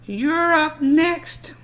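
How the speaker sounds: high, loud, drawn-out call. Acted. Boy shouting to a friend that it's his turn. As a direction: shout it across the court. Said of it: "calls out".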